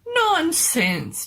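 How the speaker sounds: high, loud scoffing doctor; dismissive mad doctor. Acted. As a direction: brush the worry aside, pitched high.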